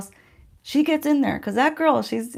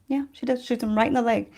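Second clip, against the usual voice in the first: normal talking voice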